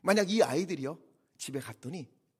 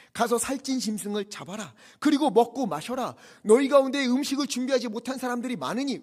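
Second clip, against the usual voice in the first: raised, commanding scripture proclamation; forceful preaching; 6 semitones higher, 9 dB louder than usual. Acted. Preacher quotes the leaders to the weeping people, forceful preaching.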